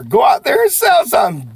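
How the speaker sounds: loud, high rallying call; coach's closing shout; pep-talk shout. Real, not acted. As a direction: send them off fired up. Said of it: "Do me a favor".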